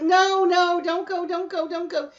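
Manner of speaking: high, insistent mock panic; retold anxious pleading. Begging him off the snake boat, high retold pleading.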